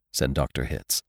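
plain reading voice

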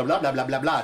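loud run of blahs after a fumble; tongue tripping into nonsense syllables; mock tongue-tied babble. Real, not acted. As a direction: babble away your own slip. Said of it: "Take my speaking course".

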